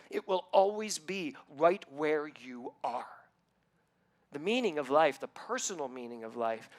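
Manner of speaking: measured, earnest preaching; inspirational sermon. Acted. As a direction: deliver it like a spiritual lesson. Preaching on the meaning of life.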